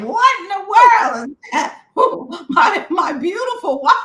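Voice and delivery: high, loud and astonished; shocked husband. Retold or imitated. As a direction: react to a horrible sound. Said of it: "was like".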